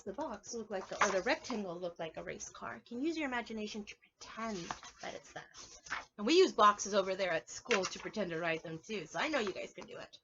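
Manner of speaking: usual speaking voice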